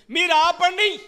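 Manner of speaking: shouted command. Retold or imitated. Pharisees order the disciples to stop, a loud command. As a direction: shout them down. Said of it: "ఏమన్నారండి?".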